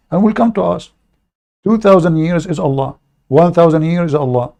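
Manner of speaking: ordinary talk, nothing marked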